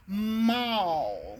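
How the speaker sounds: "Mall" with a lengthened hummed M; held first sound. Shown as a demonstration. Teacher giving a rhyme starting with M, "Mall" drawn out. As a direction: stretch the M and the ending of "Mall". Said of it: "begins with an M".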